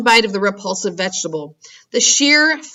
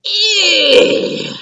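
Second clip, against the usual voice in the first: high shrill roaring cry; giant roar of disgust; 11 semitones higher, 6 dB louder than usual. Acted. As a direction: roar in revulsion. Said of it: "roared the Blood Bottler".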